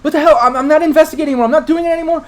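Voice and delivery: loud, high-pitched panicked protest; high, loud, terrified denial; panicked scream. Acted. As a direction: scream it out, frantic to be let go. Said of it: "She just kinda screams out".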